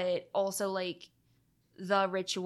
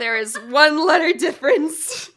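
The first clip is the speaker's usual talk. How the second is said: laughing while talking, louder and higher; 7 semitones higher, 16 dB louder than usual. Real, not acted. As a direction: squeeze the words out through real laughter. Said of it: "laughing at own misread".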